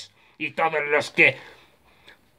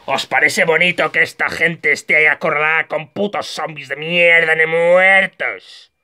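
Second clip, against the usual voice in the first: mock outrage; 3 semitones higher, 3 dB louder than usual. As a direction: berate the audience, stretching the last words. Indignado por la gente acorralada.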